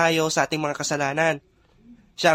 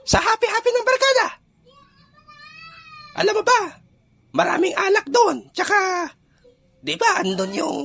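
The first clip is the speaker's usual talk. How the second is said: very high-pitched cartoonish buddy voice, excited; high put-on voice, coaxing him to party; mischievous tempting friend. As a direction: egg a pal on in a squeaky high voice. Plays Goryo tempting his friend.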